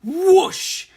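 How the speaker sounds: high, loud whooshing rush of air; whoosh sound effect. Acted. Narrator voicing a truck rushing past, loud whoosh.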